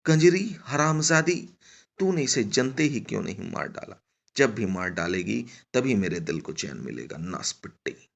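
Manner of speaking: acted furious shouting that subsides; screaming abuse. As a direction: open at a yell, then let it sink. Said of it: "बंजारन अभी भी चिल्लाए जा रही थी".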